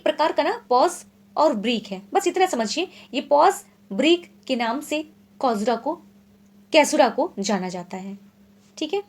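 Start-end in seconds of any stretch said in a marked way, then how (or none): none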